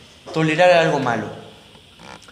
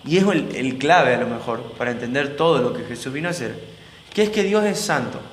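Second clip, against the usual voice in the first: ordinary delivery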